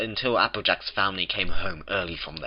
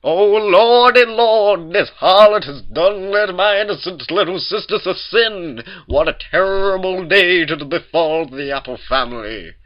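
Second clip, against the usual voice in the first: much higher, much louder slow ranting lament; a fire-and-brimstone wail; hammed-up preacher Big Mac; 11 semitones higher, 10 dB louder than usual. A narrator's reading. Big Macintosh laments his sisters' sin, preaching. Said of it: "Big Macintosh doesn't talk like that".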